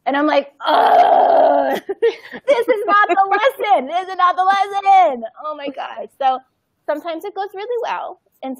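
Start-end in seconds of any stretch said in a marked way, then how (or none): 0.7-5.2 high, slow, laughing exasperation; exasperated groan. Retold or imitated. Mother despairing that her lesson backfired, slow laughing groan.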